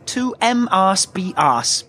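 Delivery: "M arse be arse" in slow, separated syllables; mispronunciation. Acted. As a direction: slowly misread Mars bars as "M arse be arse". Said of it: "You mean Mars bars".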